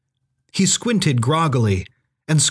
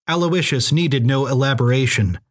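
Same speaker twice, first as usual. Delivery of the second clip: plain reading voice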